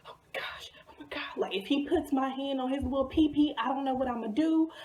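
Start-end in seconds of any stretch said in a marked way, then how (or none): 0.1-1.2 whispered mock panic, all breath; hyperventilating. Girl whose crush just took her hand, mock hyperventilating. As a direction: gasp it out in a voiceless, acted fluster. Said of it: "I'm sitting over here hyperventilating".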